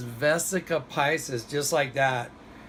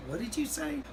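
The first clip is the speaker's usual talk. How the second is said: shocked, much higher disbelieving question; surprised; 11 semitones higher, 4 dB quieter than usual. Retold or imitated. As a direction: blurt it out in stunned disbelief. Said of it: "I was so surprised".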